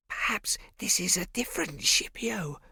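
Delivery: put-on slow, breathy child whisper. A narrator's reading. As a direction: whisper it like a nervous kid. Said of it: "Bo whispered to Prosper".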